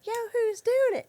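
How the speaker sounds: kids mimicking. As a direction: echo mom back like a bratty kid. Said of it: "they'd go like".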